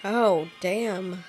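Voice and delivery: ordinary talk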